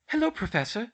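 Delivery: nervous. A narrator's reading. Schoolgirl greets her professor at the door, nervously. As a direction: greet them in a lifted, nervous girl's voice. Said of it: "Hermione said nervously".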